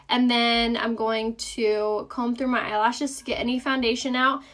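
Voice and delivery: ordinary talk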